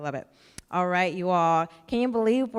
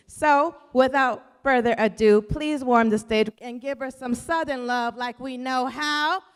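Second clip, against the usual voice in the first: rally the crowd, pitch soaring on the name; emcee introduction; 4 semitones higher, 3 dB louder than usual. Real, not acted. Host calling for applause as the poet takes the stage, rousing high call.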